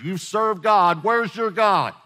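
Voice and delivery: insistent, high-pitched goading; taunting accuser. Acted. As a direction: throw it at them as Satan's taunt. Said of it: "That's the way Satan is".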